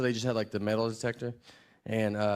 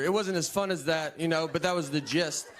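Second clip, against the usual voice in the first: usual speaking voice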